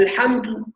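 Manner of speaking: "الحمد" ending in "tu" instead of "du"; dal as ta. Shown as a demonstration. Teacher imitates a common error, الحمد said as al-hamtu. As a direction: turn the d of "الحمد" into an airy t. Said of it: "بعض الناس تنطق الدال تاء".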